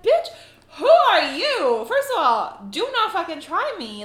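hammed-up confrontational outburst; indignant challenge. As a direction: retell it as a bold telling-off. Retold confrontation with the date.

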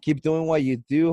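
ordinary talk, nothing marked

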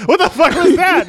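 high-pitched mock outrage, half laughing; comic high yelp of disbelief; shocked crowd. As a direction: react like an appalled onlooker. Shocked crowd reacts to shooting.

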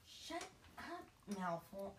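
Trelawney voice by mistake. Shown as a demonstration. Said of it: "that's a Trelawney".

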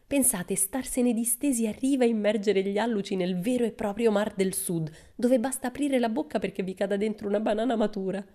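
Pippi cheerful. Acted.

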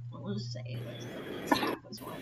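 unvoiced murmur to self; whispered aside. Real, not acted. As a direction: whisper it under breath. GM mutters aside.

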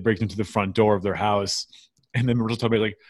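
usual speaking voice

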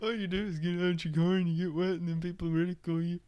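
high, amused mock summary; incredulous, high-pitched teasing; mocking repeat. Mockingly repeats friend's advice while amused.

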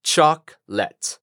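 "chocolate" slowly as CHOK, pause, LET; two separated syllables in "chocolate"; split-syllable pronunciation. Shown as a demonstration. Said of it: "al juntarlo todo, nos queda". ("chocolate" lento, en dos sílabas: chok, let)

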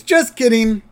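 high, loud "Just kidding"; spring it as a joke, high and loud; teasing lawyer. Retold or imitated. Lawyer teases grandpa about the catch, high and loud. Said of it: "And Mister Hutz goes".

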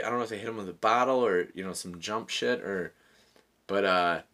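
ordinary talk

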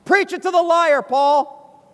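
loud, high righteous cheer; egging on. Acted. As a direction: urge the preacher on against someone else. Congregation cheers Paul on at liars.

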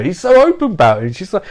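ordinary talk, nothing marked

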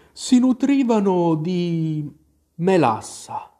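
drowsy, dragged-out storytelling; sleepy Dormouse. A narrator's reading. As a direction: tell it as if barely awake. Sleepy Dormouse tells its tale.

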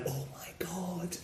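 breathy, awed whisper. Retold or imitated. The speaker recalling watching the film, whispered awe. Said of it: "I was like".

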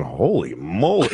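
low slow amazed "holy moly"; a TV viewer gasping in awe; impressed husband. Retold or imitated. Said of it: "pictured the guy going".

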